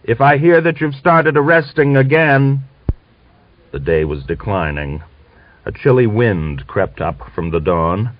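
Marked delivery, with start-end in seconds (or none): 0.0-2.6 higher, louder shouted threat; leaving officer shouting a warning back. A narrator's reading. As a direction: call back a threat from a distance. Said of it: "turned round and shouted".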